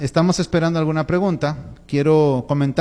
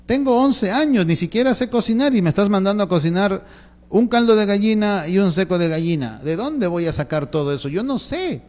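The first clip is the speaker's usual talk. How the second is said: put-on higher-pitched kid objecting to dad; overwhelmed boy's protest, acted out; protesting child; 4 semitones higher than usual. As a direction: plead that it's far too much, voice lifting. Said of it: "Mi hijo se va a quedar así como que".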